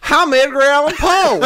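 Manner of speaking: loud annoying Poe. Acted. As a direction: announce yourself like an obnoxious bro. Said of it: "so loud and annoying".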